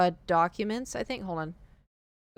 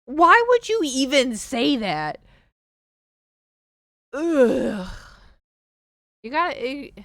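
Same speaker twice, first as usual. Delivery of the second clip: higher, louder, slow indignant voice; exasperated outburst; 6 semitones higher, 8 dB louder, slower than usual. Real, not acted. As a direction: demand why anyone says that. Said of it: "outraged, odd voice".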